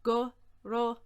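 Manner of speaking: word by word, firmly projected; slow, with a gap after each word; slow breakdown. Shown as a demonstration. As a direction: spell it out slowly for learners.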